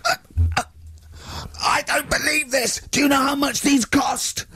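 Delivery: high-pitched, slow, furious shout; mock teacher rage. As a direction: ham up a teacher losing it. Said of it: "he went mental".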